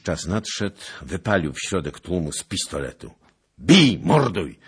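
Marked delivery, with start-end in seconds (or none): 3.6-4.6 loud commanding yell, drawn out; bellowed attack order; shouted battle cry. A narrator's reading. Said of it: "Krzyknął".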